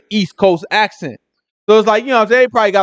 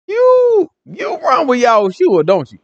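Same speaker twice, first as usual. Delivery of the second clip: high, drawn-out mock accusation; stretched, high-pitched finger-pointing; accusing onlooker; 7 semitones higher, slower than usual. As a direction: play a suspicious bystander calling him out. Onlookers accuse Peter in retelling.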